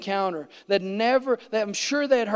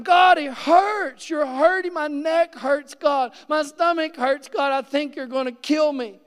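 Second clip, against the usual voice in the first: anguished pleading; 6 semitones higher than usual. Retold or imitated. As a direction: beg God through the pain, much higher. Re-enacts crying out in pain.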